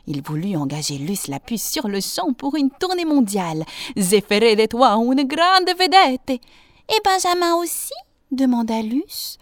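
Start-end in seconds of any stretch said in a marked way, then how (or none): 4.0-6.3 loud, higher showman's promise; grand circus director. A narrator's reading. Circus director wants to hire the flea, grand loud showman. 6.9-7.9 high, small questioning voice; little flea asking. A narrator's reading. Flea asks circus director about a companion, high little voice.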